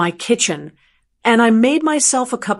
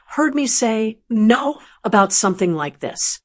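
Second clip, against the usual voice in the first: plain reading voice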